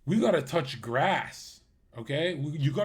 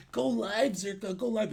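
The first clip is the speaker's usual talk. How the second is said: higher-pitched whiny imitation of viewers; mock moaning; 5 semitones higher than usual. Streamer mimics moaning fans begging him to go live, mock moaning. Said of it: "and moaning".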